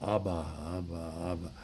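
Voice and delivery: put-on low, soft elderly babble; feeble old person wanting food. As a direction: mumble it low like a helpless old pensioner. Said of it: "when they want to get fed".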